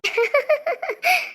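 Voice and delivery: a high, breathless burst of laughter; overjoyed high laugh; giddy laugh. Acted. Overjoyed after hearing I love you.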